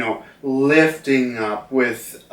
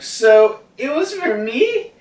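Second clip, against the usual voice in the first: high, surprised girl's voice, lightly voiced; 12 semitones higher than usual. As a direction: ask it as a startled young girl, much higher. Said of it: "And Otaku's like".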